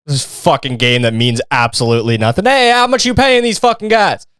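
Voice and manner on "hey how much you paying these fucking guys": high taunting mock outrage; mock accusation. Heckling the team over paying refs, mock accusing. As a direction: taunt them about bribing refs.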